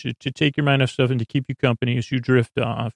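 ordinary talk

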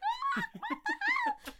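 very high, drawn-out mock scream with laughter; high laughing fangirl shriek, re-enacted; retold excited squeal. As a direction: squeal as if losing it at a gig. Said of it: "that was so sick. I was like".

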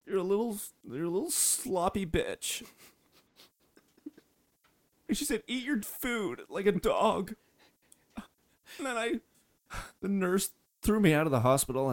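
high, tearful retelling; halting, high-pitched weepy voice; crying. Acted. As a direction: push the words out through tears. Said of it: "I think Nick's crying".

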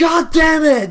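very high, loud, slow hammed-up yell; mock furious shout. Imitating the airport officer losing it, hammed-up shout. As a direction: roar it like an exploding officer, overdone.

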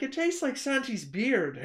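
high, slow, loud Irish impression; comic put-on Irish voice; Irish accent. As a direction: imitate an Irishman, drawn out and high. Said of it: "so easy to pick up that accent".

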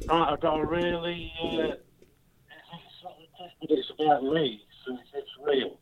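phone held away. Real, not acted. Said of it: "could you speak into the phone".

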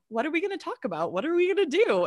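high-pitched flustered questions; retold nervousness. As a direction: act out pre-interview jitters. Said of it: "nervous for this interview".

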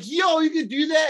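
high, astonished question; imitating an amazed hero. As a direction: sound blown away. Characters amazed.

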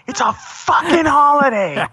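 high, slow yelled insistence; loud indignant. Retold or imitated. Defending Saint Patrick's Day to his friend, high shout. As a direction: shout it like he insulted your holiday. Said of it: "And I got loud".

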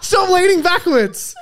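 loud, slow, high laughing yell; laughing shout. Real, not acted.

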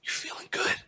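imitating a hushed phone whisper; talking real low. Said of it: "talking real low".

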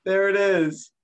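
loud, high gleeful shout; excited cheer. Real, not acted. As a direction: cheer the moment on screen. Cheering the apple throw clip.

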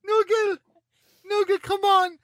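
high-pitched exclamation of disbelief; scandalised high plea; exasperated calling out. Real, not acted. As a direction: call out in laughing protest, pitch way up. Host calling out incredulously to guest.

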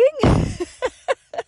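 a high rising giggle of delight. Real, not acted. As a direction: giggle up high at your own surprise. Laughs after quoting her own astonishment.

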